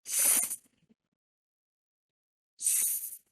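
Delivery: a soft voiceless hiss, S; a whispered S hiss for children; s sound demo. Shown as a demonstration. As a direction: breathe out a quiet hissing S. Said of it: "the letter S makes the sound".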